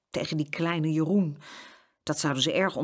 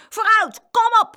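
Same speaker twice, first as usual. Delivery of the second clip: high, loud goading shout; egging on an opponent; called-out challenge; 10 dB louder than usual. A narrator's reading. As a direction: shout a plucky boy's dare. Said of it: "riep Jeroen".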